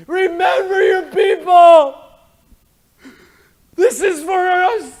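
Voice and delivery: high, slow shouted pleading; desperate shouted prayer; crying out. Pretend. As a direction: wail to heaven for rescue. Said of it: "I just wanted to be able to cry and scream".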